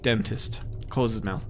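ordinary delivery

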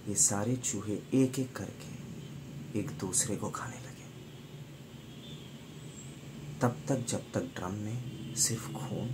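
soft, slowed and ominous; grim, hushed storytelling. Retold or imitated. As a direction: let the horror sink in quietly. Grim climax of rats eating each other. (दबी, धीमी, डरावनी आवाज़ में)

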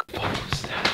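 hushed shocked whisper; startled whisper. Real, not acted. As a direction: whisper it, alarmed. Startled by crash.

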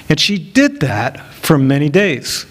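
usual speaking voice

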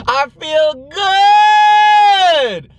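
excited exclamation. Real, not acted. Telling viewers he feels good, excited and very high. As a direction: burst out very high and loud.

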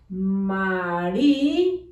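"María" slow, split as a long "Marí", pause, then "a"; sounding out. Shown as a demonstration. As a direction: dictate "María" in stretched pieces. Teacher sounds out word for writing.

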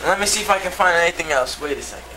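usual speaking voice

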